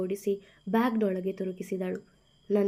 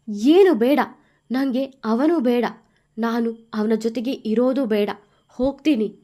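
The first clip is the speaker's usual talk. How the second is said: angry refusal; 9 dB louder than usual. A narrator's reading. Wife refusing, storming out.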